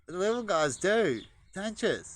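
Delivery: high loud coo to the little ones. Real, not acted. Cooing to the baby pigeons about their dirt baths, high. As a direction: tease the babies fondly.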